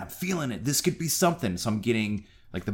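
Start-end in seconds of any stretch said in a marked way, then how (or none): 0.0-1.6 mildly excited, pitched higher; budding excitement. Retold or imitated. Telling himself this date could work, mildly excited. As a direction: let hopeful excitement lift the voice. Retold thrill before a first date.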